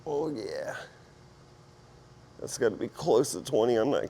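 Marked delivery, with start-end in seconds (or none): none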